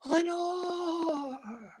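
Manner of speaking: soft echoing call of the name, fading away; echoed whisper. A narrator's reading. The echo murmurs the lost name back, softly fading. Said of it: "the echo murmured back the word".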